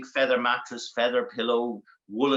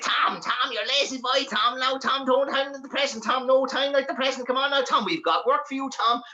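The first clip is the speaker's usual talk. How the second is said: high, nagging imp voice; high-pitched goblin chivvying; little demon; 11 semitones higher than usual. Acted. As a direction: pester him in a high demon squeal. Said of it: "like little demons".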